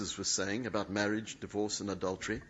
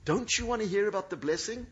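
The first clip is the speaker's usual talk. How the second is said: higher, coaxing invitation; coaxing Jesus; 8 semitones higher, 4 dB louder than usual. Acted. Jesus coaxing the evasive woman, lightly higher. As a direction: tempt her gently with the offer.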